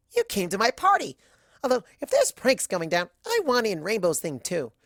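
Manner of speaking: high, loud and bouncy; an excitable party pony gushing; Pinkie's bubbly voice. Acted.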